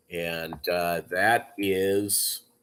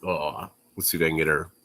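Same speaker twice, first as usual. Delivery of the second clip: ordinary talk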